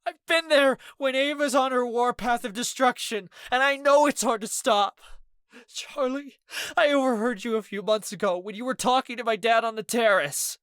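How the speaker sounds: high, loud tearful pleading; crying. A narrator's reading. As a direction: sob it out to the friend. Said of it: "Ez cried harder".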